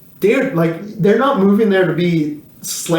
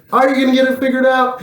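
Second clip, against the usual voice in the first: higher, rushed, incredulous retort; incredulous challenge; 6 semitones higher, much faster than usual. Retold or imitated. Host challenging a jobless man's plan, incredulous. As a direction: throw the question back in disbelief, fast and higher.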